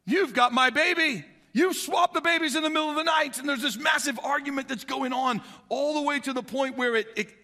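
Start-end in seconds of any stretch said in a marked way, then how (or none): 0.1-3.4 higher, louder accusing cry; angry accusation. Retold or imitated. Mother confronting the woman who swapped babies, angry. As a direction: point the finger and demand her baby.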